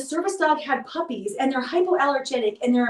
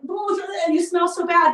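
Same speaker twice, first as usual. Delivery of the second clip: high cooing puppy talk; cooing to the scared puppy in her lap; baby talk to puppy; 6 semitones higher, 3 dB louder than usual. Retold or imitated. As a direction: gush up high at a frightened puppy.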